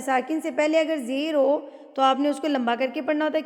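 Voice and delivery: usual speaking voice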